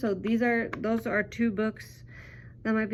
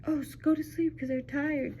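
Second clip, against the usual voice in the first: lightly voiced children's answer, higher; imitating kids; 5 semitones higher, 3 dB quieter than usual. Teacher voicing kids' answer about the yawning crab, imitating kids. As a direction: pitch it up a little, like kids answering. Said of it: "And they're like".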